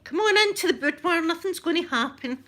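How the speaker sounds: high, loud mock enticement; hammed-up come-hither call; playful luring. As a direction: coax them in with a sing-out, teasing. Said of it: "Luring yous in".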